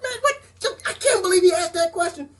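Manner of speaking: high, cracking disbelief, voice nearly breaking; can't believe what he just heard; incredulous outburst. Retold or imitated. Vlogger recalls watching the hearing, high incredulous cry. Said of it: "I was like".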